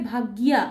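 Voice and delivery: ভাগ্যিয়া with the য clearly sounded after the g, "bhagya"; Sanskrit pronunciation. Shown as a demonstration. Teacher shows students the Sanskrit sound of ভাগ্য, "bhagya". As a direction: give ভাগ্যিয়া its full Sanskrit ya glide. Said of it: "সংস্কৃতের উচ্চারণ কী হবে".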